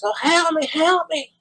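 high, helpless damsel wail; mock a damsel in distress; fluttering cries for help. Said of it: "the damsel in distress".

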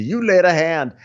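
ordinary talk, nothing marked

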